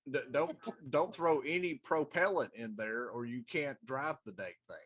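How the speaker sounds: ordinary talk, nothing marked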